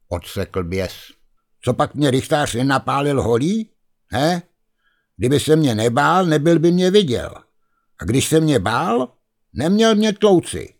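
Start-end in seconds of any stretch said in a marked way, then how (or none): 1.6-10.7 higher, rising to a sharp peak; indignant ghost. Acted. The ghost retorts to Kuba about the beating, indignant. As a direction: argue back in grievance.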